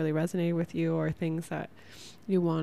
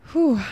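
big loud whew of relief, pitched up; relieved exhale; 8 semitones higher, 9 dB louder than usual. Real, not acted. Podcaster to listeners, letting out a loud relieved whew. Relief after finishing a thought.

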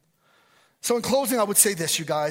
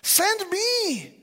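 much higher, louder plea to the Lord; Isaiah's eager plea; 13 semitones higher, 5 dB louder, slower than usual. Retold or imitated. Isaiah offering himself to the Lord, high eager plea. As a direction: beg to be sent. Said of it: "as Isaiah".

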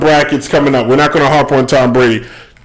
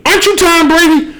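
high-pitched astonished recognition; starstruck surprise; 13 semitones higher than usual. Acted. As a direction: blurt it out, amazed to spot a star. Acted recognition of a celebrity.

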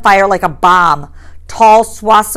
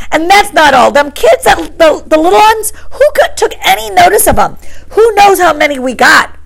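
higher, louder scolding outburst; lost his temper; 9 semitones higher, 5 dB louder than usual. A narrator's reading. Piggy scolding the boys over the fire, angry. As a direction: tell them off, losing patience. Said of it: "Piggy lost his temper".

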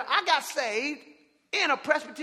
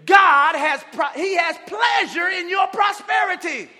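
loud, high, slow shouted preaching; preaching at full force; 6 semitones higher, 10 dB louder than usual. Acted. Pastor telling church God wants them blessed, shouting. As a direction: drive it home to the crowd.

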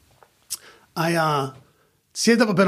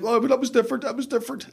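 higher mock whine of a friend making excuses; mock defensive friend; 8 semitones higher, faster than usual. Imitating friend denying he cried, higher mock excuse. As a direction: pitch it up like a sheepish friend.

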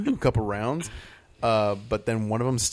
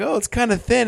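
mock disappointed remark; 12 semitones higher, 6 dB louder than usual. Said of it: "they're like".

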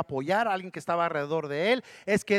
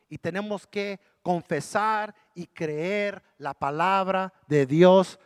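slow, ending much louder; building emphasis; 8 dB louder, much slower than usual. Acted. Preacher urging daily confession, rising to a loud finish. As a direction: build to a loud final push.

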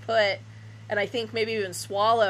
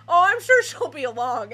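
mock breezy reassurance; 4 semitones higher, 6 dB louder than usual. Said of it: "everybody's like".

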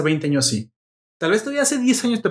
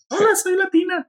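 put-on much higher, sassy girly voice; sassy girl voice. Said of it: "Y contesta América, que está bien brosa".

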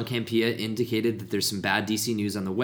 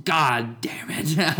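slow whispered groan; whispered curse; 6 semitones higher, much slower than usual. Retold or imitated. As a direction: hiss it out in despair.

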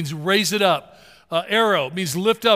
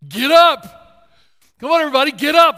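rousing shout; 8 semitones higher, 9 dB louder than usual. Acted. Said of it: "what is Jesus saying to me".

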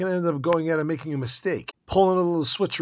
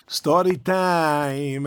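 drawn-out announcement; 5 dB louder, much slower than usual. Acted. Announces a story in the lesson.

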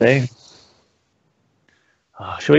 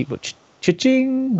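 cha-ching; 13 semitones higher, 3 dB quieter than usual. Acted. Cash register sound for bid.

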